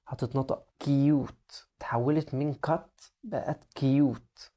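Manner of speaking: "كيوت" said slowly, long you sound; long u in cute. Shown as a demonstration. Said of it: "شايف اللي حصل".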